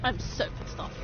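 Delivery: quiet whispered sulk; sulky whisper. Real, not acted. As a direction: hiss it under your breath, fed up. Sulking after losing health.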